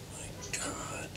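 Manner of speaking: soft whispered "My God"; whispered dismay. Real, not acted. Appalled by drunk students, a soft whisper. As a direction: barely breathe the words.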